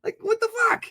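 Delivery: can't believe what he's hearing; incredulous at the caller's answer; disbelief. Real, not acted. Exasperated at caller.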